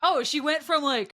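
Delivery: jokey imitation of a thick Manitoba accent; mock Manitoba accent. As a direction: put on a thick Canadian regional accent, breaking into laughter. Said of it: "that's how they talk in Manitoba?".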